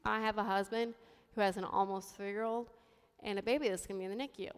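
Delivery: soft, subdued inner voice; thinking to oneself. Retold or imitated. Mother in the ER thinking of her family, quiet inner voice. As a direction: say it quietly, as a private thought. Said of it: "And I think to myself".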